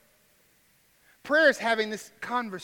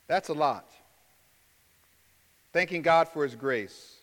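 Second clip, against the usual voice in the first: ordinary talk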